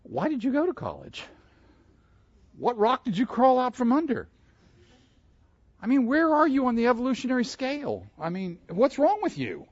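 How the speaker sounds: high, incredulous mock scorn; mocking classmates. Preacher mimicking classmates who mock his faith, high and scornful. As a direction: sneer it up high like the doubting crowd. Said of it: "they're all looking at me like".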